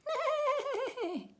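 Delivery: high, quavering whinny on the word; horse neigh. A narrator's reading. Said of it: "he said to the little boy".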